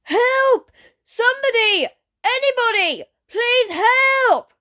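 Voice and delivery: put-on high, loud desperate child shout; child crying for help. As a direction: scream for help like a small kid. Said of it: "a petite voice calling".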